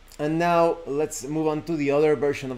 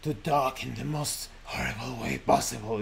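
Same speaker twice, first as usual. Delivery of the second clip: softer, eerie storyteller voice; horrible scary voice; 3 semitones lower, 6 dB quieter than usual. Shown as a demonstration. Showing viewers the scary narrator voice he'll use, soft and creepy. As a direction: creep the words out like a horror narrator. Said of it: "bring out that scary part".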